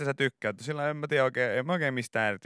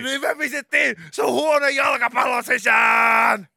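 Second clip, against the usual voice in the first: much higher, loud slow cheering; much higher, louder gushing shout; hyped host shout; 11 semitones higher, 8 dB louder, slower than usual. Retold or imitated. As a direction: sell the reveal at full volume.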